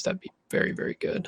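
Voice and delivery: muttering under his breath; whispered reading to himself. Real, not acted. Reading card quietly to himself.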